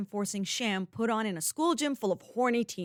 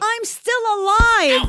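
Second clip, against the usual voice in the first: slow, much higher, loud; angry shout; 11 semitones higher, 11 dB louder, slower than usual. Acted. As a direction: spit out each word, enraged. Furious at friend over video.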